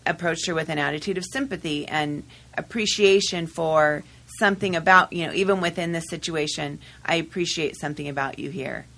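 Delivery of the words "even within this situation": usual speaking voice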